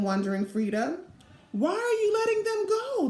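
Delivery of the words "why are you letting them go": put-on higher-pitched childish demand. A narrator's reading. Fiona demands to know why the guide frees them, higher. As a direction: press for an answer. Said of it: "demanded Fiona".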